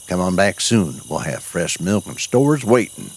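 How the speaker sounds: plain reading voice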